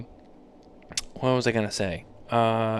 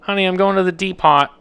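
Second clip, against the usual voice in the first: dad voice; 7 semitones higher, 6 dB louder than usual. Retold or imitated. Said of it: "that's what he used to say to my mom".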